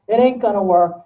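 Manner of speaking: loud, insistent naysaying; emphatic mock pessimism. Neurotic interviewee insisting on failure, loud and emphatic. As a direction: punch it out loudly as a stubborn naysayer. Naysayer repeats doom louder.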